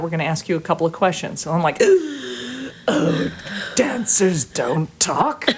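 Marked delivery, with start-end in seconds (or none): 1.8-2.8 gasp. Retold or imitated. Shocked at interview news. 2.9-5.5 mock dismay. Mock panic over interview.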